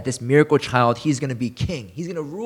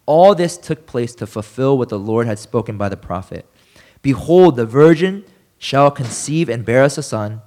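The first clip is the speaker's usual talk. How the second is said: scripture reading; 3 semitones lower, 5 dB louder than usual. Acted. Pastor reading Luke to his congregation, solemn and louder. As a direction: read it out louder, with a preacher's weight. Reads Matthew's prophecy aloud.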